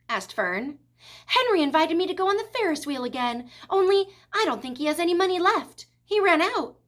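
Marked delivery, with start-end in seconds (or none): 1.3-6.8 put-on eager child. A narrator's reading. Child explains why she needs money.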